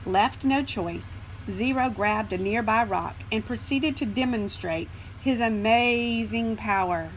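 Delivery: ordinary delivery, nothing marked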